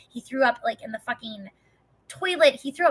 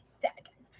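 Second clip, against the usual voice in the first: whispered aside; 12 dB quieter than usual. Real, not acted.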